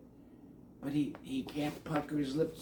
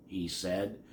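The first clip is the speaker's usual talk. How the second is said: ordinary delivery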